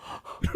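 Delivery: acted gasp of shock; sharp intake, retelling shock. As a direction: gasp like a stunned kid. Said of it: "I was so shocked".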